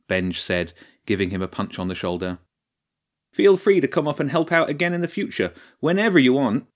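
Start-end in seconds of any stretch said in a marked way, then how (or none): 3.3-6.7 lightly voiced, upbeat stage tech; friendly invitation. A narrator's reading. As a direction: offer it generously and loud. Benj inviting.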